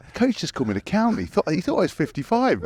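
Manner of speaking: lightly voiced, high, fast indignant complaint; a hint of an indignant teammate. Teammate complaining to a mate, mock indignant and quick. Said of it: "He comes and goes".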